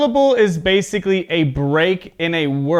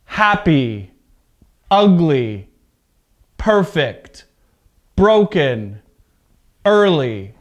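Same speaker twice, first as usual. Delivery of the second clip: first syllable stressed in Happy, ugly, perfect, broken, early; word list, each adjective hit at the front; slower than usual. Shown as a demonstration. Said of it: "stress is at the beginning".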